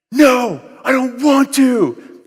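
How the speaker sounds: drawn-out, high-pitched tantrum yell, acted out; toddler's yelled refusal. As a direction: imitate a defiant child yelling, high and loud. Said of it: "He yelled at me".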